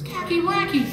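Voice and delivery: high squeaky puppet voice. Acted. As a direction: voice a tiny puppet rabbit. Puppet voice for Mister Stuff and Fluff.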